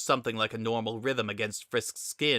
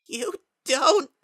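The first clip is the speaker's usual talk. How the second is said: very high, loud, cracking; breaking cry; 7 dB louder than usual. A narrator's reading. Kara breaking down before wailing at Frisk, very high and loud. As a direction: let it shatter on the last word. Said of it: "His voice cracked like ice".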